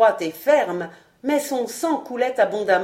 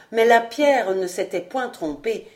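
plain reading voice

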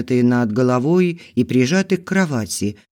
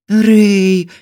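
higher, louder plaintive cry; wife pleading her husband's name; plaintive breath; 7 semitones higher, 8 dB louder than usual. A narrator's reading. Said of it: "жалобно выдохнула".

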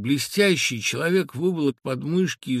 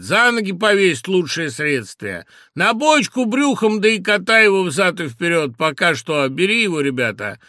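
shouted advice; 5 semitones higher, 7 dB louder than usual. A narrator's reading.

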